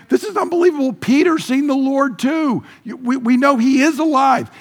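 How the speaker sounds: high, excited exclaiming; excited disbelief. Acted. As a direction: burst out with amazed joy. Disciples thrilled Jesus is alive.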